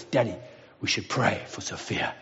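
low, soft imitation of a solemn son; hushed child. Son leaning forward to his shocked daddy, hushed. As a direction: lean in with a quiet, grave suggestion. Father voices son after accident.

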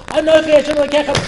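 loud, high, fast divine announcement; sudden full-volume shout; booming proclamation. Acted. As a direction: thunder it out as God's overwhelming voice. God speaks the first commandment.

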